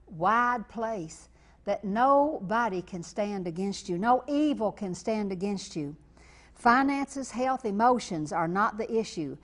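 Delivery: loud, fervent sermon cadence; preaching at full force. Acted. Televangelist assuring viewers of God's presence, fervent and loud. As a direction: drive each promise home from the pulpit.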